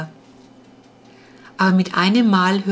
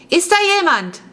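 much higher, louder call into the dark; 11 semitones higher, 4 dB louder than usual. Acted. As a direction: call out nervously into the cellar. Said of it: "rief er".

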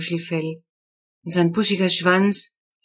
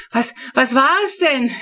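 high, loud breathless call; out of breath; 7 semitones higher, 4 dB louder than usual. A narrator's reading. Brother calling out breathless after racing down the stairs. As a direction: gasp the question after running. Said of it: "ruft Titus ganz aus der Puste".

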